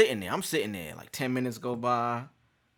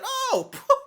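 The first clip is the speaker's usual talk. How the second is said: relief; 6 dB louder than usual. Retold or imitated. Relief hearing drug test.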